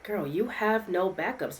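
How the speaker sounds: ordinary delivery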